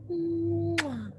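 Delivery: high smacking kiss; kiss sound. A narrator's reading. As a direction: plant a kiss on the head. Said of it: "She kissed the top".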